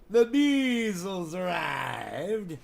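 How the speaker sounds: slow, drawn-out announcer voice; mock announcer for the dog; grand announcement. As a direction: herald the dog's arrival.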